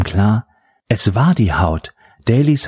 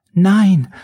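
high, loud desperate cry; calling out; 11 semitones higher, 3 dB louder than usual. A narrator's reading. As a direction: cry out after her. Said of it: "rief Catherine ihr nach".

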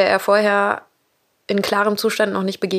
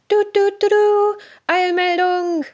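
mock jingle then Eilmeldung; news jingle; 12 semitones higher than usual. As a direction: announce breaking news playfully. Jokey breaking-news announcement.

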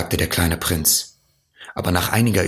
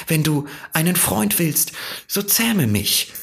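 higher, lilting fox voice; earnest fox; 9 semitones higher, faster than usual. A narrator's reading. Fox tells the prince what taming would mean, earnest and wistful. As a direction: plead the case gently as the fox.

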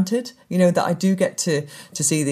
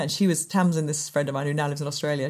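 ordinary talk, nothing marked

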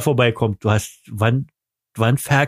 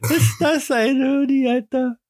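mock high voice; 13 semitones higher than usual. Mocking frozen partner.

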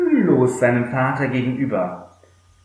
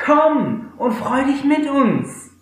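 coaxing father; 12 semitones higher, slower than usual. Acted. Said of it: "redete ihm gut zu".

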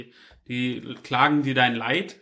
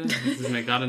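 ordinary talk

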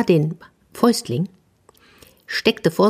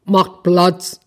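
a forceful, louder boar voice; demanding boar; 2 semitones higher, 4 dB louder than usual. A narrator's reading. Boar pushing toward the mitten, demanding. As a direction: order them aside like a boar. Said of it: "sagte das Wildschwein".

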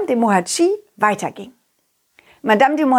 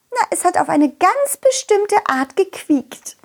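princess voice; 8 semitones higher than usual. Acted. Princess character voice.

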